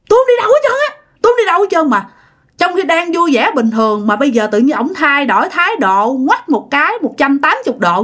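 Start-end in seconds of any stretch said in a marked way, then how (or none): 0.1-1.9 much higher, mock-girl refusal; possessed man whining like a girl; squeaky girlish voice. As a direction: refuse in a squealy put-on girl's voice. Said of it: "nói cái giọng eo éo như là con gái".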